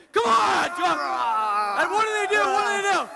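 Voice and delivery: loud, high call across the room; shouting. Real, not acted. Presenter shouting to bring the lion volunteer into the demo. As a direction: call the volunteer in and rouse the crowd.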